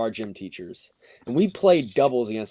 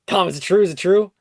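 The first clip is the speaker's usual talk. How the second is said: higher, louder, urgent asking; eager questioning; 6 semitones higher, 7 dB louder, faster than usual. Retold or imitated. Whole class asking Tom about the rumor, eager. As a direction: imitate classmates pouncing on gossip.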